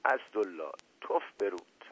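words squeezed out past a choking rage; strangled cry. A narrator's reading. Enraged prince chokes out a curse at Asadollah, strangled.

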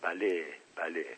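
soft, knowing repetition; quiet, meaningful agreement; subtle hint. A narrator's reading. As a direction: say it softly with a discreet hint. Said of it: "با اشارهٔ خفیفی تکرار کرد".

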